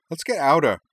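high, loud panicked cry; frantic urge to flee. Acted. Panicked flight from monster.

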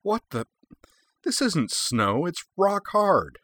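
startled, high and slow disbelief; surprised. Acted. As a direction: jump up, shocked at the hard seat. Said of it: "sprang up again in surprise".

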